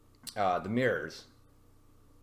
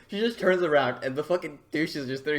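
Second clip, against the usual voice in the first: ordinary delivery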